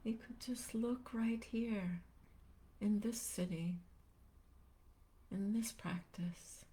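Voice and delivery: unhurried meditation guide, long pauses between phrases; calm, spacious guiding voice; guided meditation. Real, not acted. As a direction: gently lead listeners into stillness, leaving space after each phrase.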